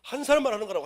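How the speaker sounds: loud emphasis. Shown as a demonstration. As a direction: drive home 사람만. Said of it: "repeated point".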